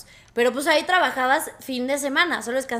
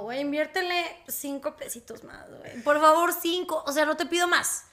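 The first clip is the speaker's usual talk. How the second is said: liver's plea; 5 semitones higher than usual. Retold or imitated. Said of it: "el hígado sí dice como".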